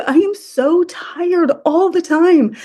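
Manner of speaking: imitating herself breaking down in tears; tearful high wail of exhaustion; bawling. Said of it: "and bawling p-, in public".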